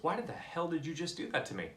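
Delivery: high-pitched, incredulous demand; acted-out outraged kid; indignant protest. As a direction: demand an answer, pitched well up. Kid confronts mentor after near drowning.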